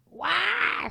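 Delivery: sudden loud, high imitated bawl; mock crying wail. Nun bursting into tears before the priest, mock wail. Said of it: "Se largó a llorar".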